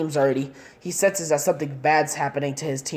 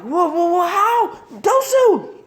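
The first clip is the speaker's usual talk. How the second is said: very high, loud cry of shock; horrified scream; 6 dB louder, slower than usual. Acted. Girl screaming as her teammate Dosu is killed, very high horrified cry. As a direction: shriek the fallen friend's name.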